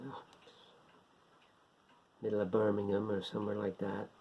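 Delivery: ordinary delivery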